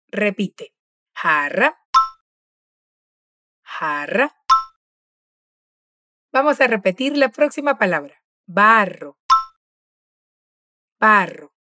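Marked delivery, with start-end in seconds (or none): 1.1-4.4 jarra with a rolled rr trill; trilled double R. Shown as a demonstration. Profesora: jarra con la erre vibrante. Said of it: "la pronunciación de esta letra es vibrante". 8.5-11.5 barro with a strong rolled rr; trilled double R. Shown as a demonstration. Profesora: barro con la erre bien vibrante. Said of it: "la pronunciación de esta letra es vibrante".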